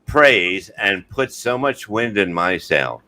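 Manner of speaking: slow, drawn-out read-aloud. Real, not acted. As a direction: read it out slowly with long pauses. Reads testimonial aloud on request.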